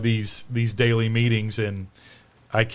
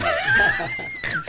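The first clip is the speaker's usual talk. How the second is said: big laugh. Real, not acted. Laughing at killing story.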